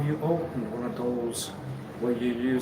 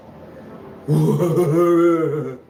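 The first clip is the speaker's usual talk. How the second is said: loud, drawn-out rolling laugh; long loud laugh; 6 dB louder than usual. Real, not acted. After "that's the root", laughing long and loud. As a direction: let a long laugh burst out. Bursts out laughing mid-talk.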